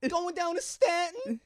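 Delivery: slow, high-pitched New York whine; high New York accent. Pretend. Touring the New York area, high New York accent.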